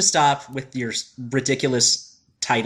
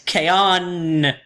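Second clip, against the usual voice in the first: drawn-out, emphatic K-On; stretched, punched-up K-On; exclaimed title; 5 semitones higher than usual. Shown as a demonstration. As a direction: say K-On with an exclamation mark. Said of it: "With two exclamation points".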